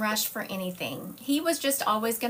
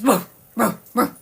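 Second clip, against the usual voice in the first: imitated dog bark; 8 dB louder than usual. Mimicking the dog Hera barking.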